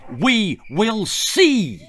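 the father overrules the guide, slow and angry. Acted. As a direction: lean on each word to shut him down. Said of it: "said Mister Crump Dump angrily".

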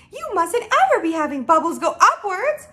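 high, loud scolding; indignant cry. Acted. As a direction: scoff with rising outrage. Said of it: "cried the B F G".

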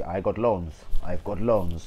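put-on Spanish-accented manager voice, quick; light mock Spanish accent, brisk delivery; Emery impression. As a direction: mimic a foreign coach saying it plainly. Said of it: "Emery a couple of se".

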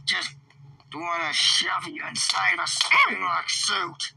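ordinary talk, nothing marked

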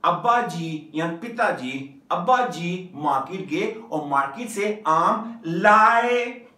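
slow sentence with a loud, drawn-out लाए at the end; stress on लाए. Shown as a demonstration. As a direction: lean hard on the final लाए. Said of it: "किस पे emphasise किया हुआ है".

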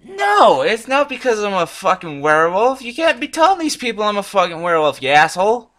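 loud, high-pitched indignant rant; exploding in protest at a ridiculous accusation; outraged denial. Real, not acted. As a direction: yell it back, offended and incredulous. Indignant at bot's werewolf question.

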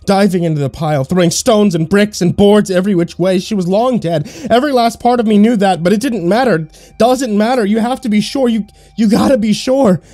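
high, loud and desperate, racing; frantic guilt. A narrator's reading. Man digging through rubble for his wife, frantic. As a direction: dig and babble in panic.

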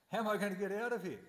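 high, loud and fast, in a new voice; panicked question. Acted. Lost sage swimming in the flood, panicked and hurried. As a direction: blurt it out like a drowning man.

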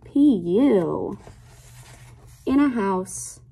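lilting picture-book read-aloud; reading to children. Acted. Teacher reading a rhyming book to her class, lilting read-aloud. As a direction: read the rhyme to little kids, savoring each line.

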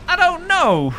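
high, loud mock Aussie mother; bad Australian mum. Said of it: "That's how your mum sounds".

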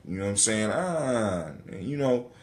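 ordinary talk, nothing marked

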